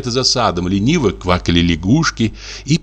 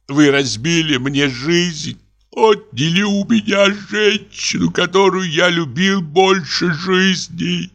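high, slow grieving lament; moaning lament; 11 semitones higher, slower than usual. A narrator's reading. Deceived husband laments his lost wife, high and slow. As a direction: let each word ache, drawn out. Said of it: "простонал он".

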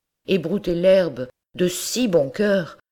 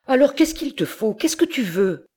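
much higher, exasperated asking; exasperated farmer; 10 semitones higher than usual. Acted. Monsieur Seguin loses patience with his goat, exasperated. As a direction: throw up your hands in disbelief.

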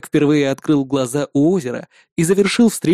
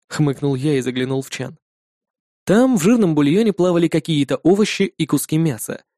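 ordinary delivery, nothing marked

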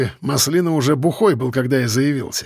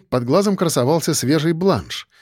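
ordinary delivery, nothing marked

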